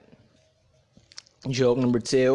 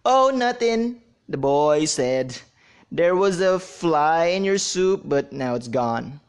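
put-on high, loud cheeky kid voice; 4 semitones higher, 5 dB louder than usual. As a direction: play a cheeky little boy. Boy voiced, higher and louder.

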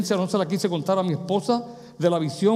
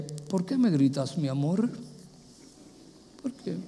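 lowered, gentle voice; 2 semitones lower, 6 dB quieter than usual. Shown as a demonstration. Husband asking his shouting love why, softly. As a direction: answer a shouter quietly and tenderly. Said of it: "bájale la voz".

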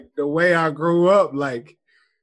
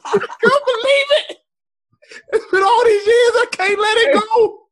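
very high, loud mock sobbing; hammed-up hurt; 6 dB louder than usual. Comedian telling friends of an old grievance, hammed-up hurt. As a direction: wail it like an overblown childhood trauma. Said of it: "I'm so hurt".

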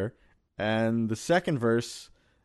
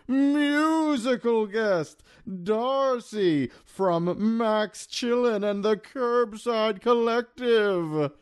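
high, slow hammed-up host intro; talk show announcer; 10 semitones higher, much slower than usual. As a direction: announce the musical guest grandly.

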